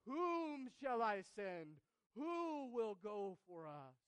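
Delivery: loud, slow call of God; the Lord calling. Acted. Preacher voicing God's call to Isaiah, loud and slow. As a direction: call out slowly with great weight.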